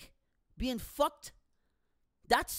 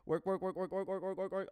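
rapid mechanical repetition of one word; nonstop work chatter; 3 dB quieter, faster than usual. Retold or imitated. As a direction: rattle it off like a machine. Mimics nonstop working.

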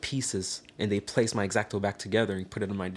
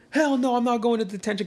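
high-pitched, outraged protest; indignant refusal; 11 semitones higher than usual. Retold or imitated. Student refusing the teacher's detention order, indignant. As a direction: act out digging your heels in.

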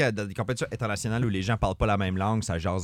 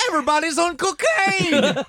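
shout; 8 dB louder than usual. Pretend. Dutch figure shouting in sketch.

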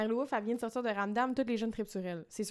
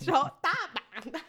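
higher, slow swear through laughter; laughing exclamation; 5 semitones higher, 4 dB louder, much slower than usual. Real, not acted. Host laughs at her own French-accent impression, swearing through giggles. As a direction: blurt it while cracking up.

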